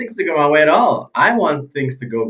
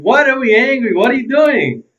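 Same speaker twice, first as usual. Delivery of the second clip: exasperated self-scolding; 11 semitones higher, 6 dB louder than usual. Pretend. Scolding himself for being angry.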